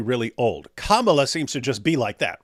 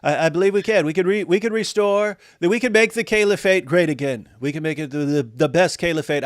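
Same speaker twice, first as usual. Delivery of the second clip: mock campaign speech. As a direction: brag like a showman candidate at a rally.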